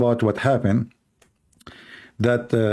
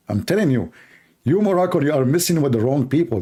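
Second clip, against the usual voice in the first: ordinary delivery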